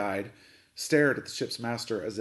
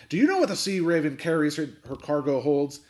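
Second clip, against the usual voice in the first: loud, raised-pitch captain's bellow; 4 semitones higher, 6 dB louder than usual. A narrator's reading. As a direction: bellow it across the cabin. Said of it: "Captain Swain bellowed".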